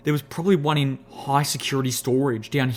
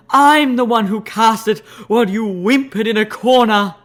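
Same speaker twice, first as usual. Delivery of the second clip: boastful Alicorn voice; 7 semitones higher, 8 dB louder than usual. Acted.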